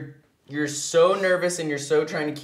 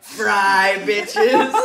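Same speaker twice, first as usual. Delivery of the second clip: mock mean girl; 6 semitones higher, 6 dB louder than usual. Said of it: "being like".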